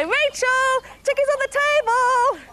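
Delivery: high, loud mum calling up the stairs; mum impression. Mum calling Rachel from the bottom of the stairs, hammed-up holler. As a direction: holler it like a mum summoning her child. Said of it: "That's quite a good mum impression".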